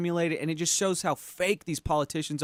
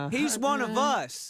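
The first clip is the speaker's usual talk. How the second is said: mock impressed voter; 9 semitones higher than usual. Co-host mocks won-over Southern voters, impressed high voice. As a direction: play a gullible Southern voter, pitched way up.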